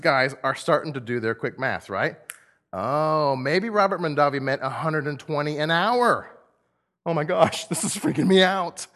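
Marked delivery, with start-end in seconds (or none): 2.7-8.9 giddy, louder exclamation of dawning hope; excited worker. Acted. First workers doing their quick math, excited. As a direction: act out a worker getting carried away.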